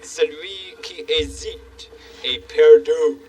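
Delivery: slow, grand French saying; French quote. Retold or imitated. King quoting Bonaparte to the shy handyman, slow French. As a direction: quote Napoleon in French with ceremony. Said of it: "As, uh, Bonaparte used to say".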